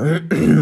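a loud, deliberate throat clearing. A narrator's reading. Robber Grabsch clearing his throat to escape Olli, loud throat clearing. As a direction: clear your throat loudly to dodge the question. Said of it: "räusperte sich laut".

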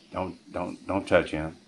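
low, softly retold warning; hushed, low-pitched mock threat; quiet warning. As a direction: imitate a wife warning someone off under her voice.

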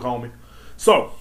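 normal talking voice

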